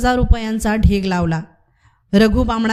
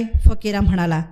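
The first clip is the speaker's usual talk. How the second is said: plain reading voice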